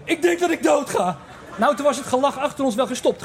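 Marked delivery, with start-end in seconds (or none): 0.0-1.2 high, strained voice, half-laughing; mock dying panic. Stoned friend fears he is dying, high mock panic. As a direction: play the friend sure he is dying. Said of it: "En die vriend naast me zegt".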